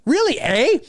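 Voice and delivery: high, playful Canadian "Really, eh?". Shown as a demonstration. Streamer hamming up his own Canadian turn of phrase to a viewer, Canadian "eh". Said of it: "That's very Canadian".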